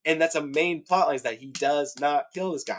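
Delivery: high-pitched, worked-up rant; fired up. Real, not acted. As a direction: plead your grievance as an outraged book fan. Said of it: "I'm really fired up about this".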